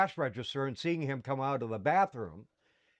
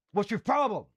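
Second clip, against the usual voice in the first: higher-pitched mock confrontation; irritated challenge, pitched up; retold angry dad; 5 semitones higher, 3 dB louder than usual. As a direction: imitate a dad turning on his kid. Angry father confronts child in car.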